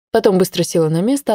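ordinary delivery

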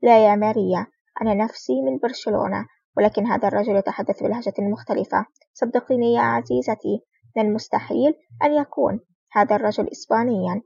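plain reading voice

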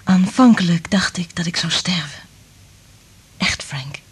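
hushed, fearful whisper. Acted. Liz confides her terror to Frank in a whisper.

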